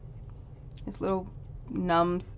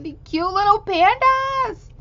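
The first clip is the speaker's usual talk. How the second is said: high, slow, louder coo of delight; gushing squeal; 12 semitones higher, 6 dB louder than usual. Real, not acted. Admiring the panda card, cooing in delight. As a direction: coo over the cute pandas.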